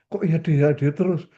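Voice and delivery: annoyed wife. Retold or imitated. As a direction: complain like a wife who wants a real answer.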